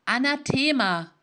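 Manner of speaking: lauter, höherer, brechender Ausruf; brüchiger Ruf. A narrator's reading. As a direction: ruf es entsetzt mit brüchiger Stimme. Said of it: "rief der Traviergeweihte mit brüchiger Stimme". (Geweihter verflucht den Fremden, brüchig laut)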